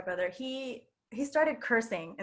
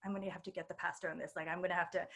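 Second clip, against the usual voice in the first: put-on hushed older student; 5 semitones lower, 10 dB quieter, faster than usual. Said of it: "she was like".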